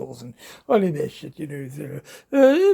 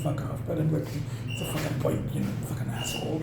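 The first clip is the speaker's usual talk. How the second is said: under-breath mock curse, half-laughing; muttered insult; 3 semitones lower, 7 dB quieter than usual. Telling the rude neighbor off under breath, whispered. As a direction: whisper it like you wish you'd said it. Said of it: "I felt like saying".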